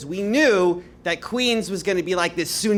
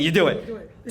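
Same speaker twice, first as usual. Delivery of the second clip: ordinary talk, nothing marked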